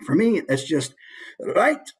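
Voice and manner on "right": high, loud Scottish "Right"; Scottish key word, pitched way up; Scottish accent. Shown as a demonstration. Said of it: "let's take Scottish, for example".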